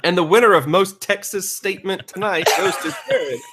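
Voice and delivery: playful award-show host voice; mock announcer. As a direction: hand out the award like a showman.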